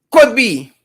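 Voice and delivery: Could punched, sceptical fall on be; Could stressed, then falling off on be. Shown as a demonstration. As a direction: lean on Could to mock the promise. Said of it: "Not shall be".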